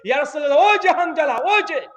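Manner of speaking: shouting from behind. Retold or imitated. Companion spotting Hanzala, shouting to the Prophet. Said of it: "পিছন থেকে চিৎকার দিচ্ছে".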